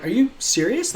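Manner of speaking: high, disbelieving question; retelling his shocked disbelief; incredulous. Retold or imitated. Incredulous retold protest to staff.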